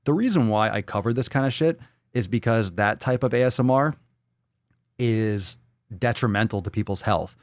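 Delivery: ordinary talk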